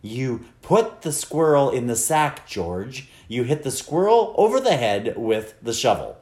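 emphatic, deliberate command; insistent, measured order. A narrator's reading. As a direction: hammer out each phrase for someone not getting it. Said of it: "She said them louder. She said them more slowly".

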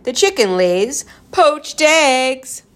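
high, loud, slow punchline; gleeful punchline. A narrator's reading. A joker tops the poodle riddle, gleeful. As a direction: stretch the gag out with relish.

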